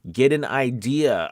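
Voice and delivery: normal talking voice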